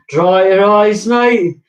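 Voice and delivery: loud, high mocking shout across the carriage; football terrace yell. Fan taunting the other side on the train, loud high yell. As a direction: bellow it like a rival fan taunting. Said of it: "the other side were like".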